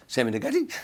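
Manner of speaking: retold fit of nervous giggles cutting the sentence; nervous laughter. Nervous comic explaining to Sammy, breaking into laughter. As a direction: start earnestly, then crack up. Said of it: "I break him laughing again".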